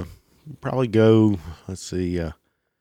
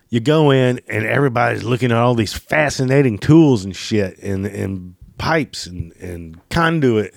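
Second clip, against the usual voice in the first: usual speaking voice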